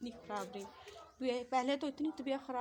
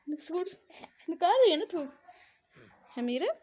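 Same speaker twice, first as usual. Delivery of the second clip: higher, louder playful coo; 4 semitones higher, 7 dB louder than usual. Real, not acted. Mother teasing baby blowing spit.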